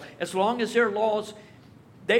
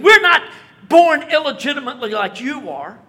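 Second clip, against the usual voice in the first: loud, much higher, offended snap; indignant retort; 6 semitones higher, 13 dB louder than usual. Retold or imitated. Pharisees hurling an insult back at Jesus, loud and indignant. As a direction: fling the insult back at him.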